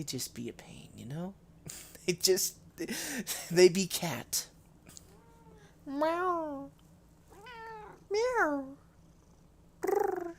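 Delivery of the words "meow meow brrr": high meowing and trilling; cat meow. Pretend. Imitating the cat.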